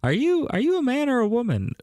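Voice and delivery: high-pitched mock questioner; playful squeaky question; put-on questioner. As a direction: ask it way up high. Jokey flirtation, asking in put-on voice.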